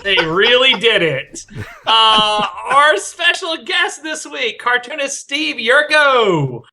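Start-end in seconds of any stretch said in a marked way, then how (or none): 2.6-6.6 slow, grand guest introduction; announcer intro. Acted. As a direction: present the guest like a host. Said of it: "host introduction".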